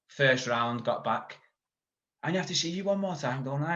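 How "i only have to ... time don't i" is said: much higher and fast, half joking; eager. Retold or imitated. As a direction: rattle it off up high like a keen kid. Retelling his words to the coach between rounds.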